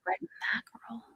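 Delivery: puzzled whispered reading of "red mackerel". Real, not acted. Reading an unfamiliar cat term.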